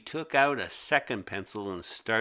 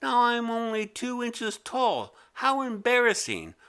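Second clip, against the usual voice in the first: much higher indignant creature voice; the creature protests, voice pitched up high; squeaky creature; 11 semitones higher than usual. Acted. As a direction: squeak up in alarm. Creature complaining.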